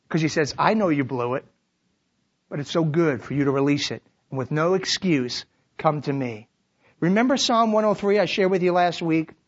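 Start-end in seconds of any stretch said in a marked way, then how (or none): 0.6-6.5 low, calm invitation; gentle reassurance. Acted. God reassures the one confessing.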